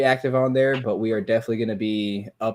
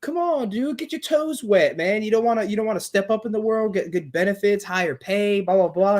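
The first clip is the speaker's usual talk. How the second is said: pushy manager voice; 9 semitones higher than usual. Retold or imitated. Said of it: "He goes".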